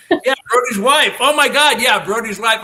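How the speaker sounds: loud, high laughing correction. Real, not acted. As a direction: blurt it out through a laugh. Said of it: "I meant to say husband".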